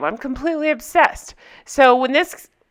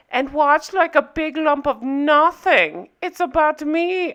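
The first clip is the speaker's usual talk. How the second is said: slow, higher, exaggerated European accent; Anna's accent; 5 semitones higher than usual. Recapper continuing the heroine's quote, exaggerated accent. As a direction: draw it out in a high mock-German accent. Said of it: "cannot stop with this accent".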